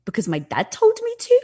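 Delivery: high, sheepish blurted answer; caught-off-guard answer. Retold or imitated. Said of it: "I literally stopped and I was like".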